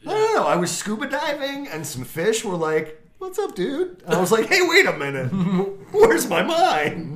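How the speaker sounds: high goofy storytelling voice; goofy and wide-eyed, laughing through; silly high voice. Pretend.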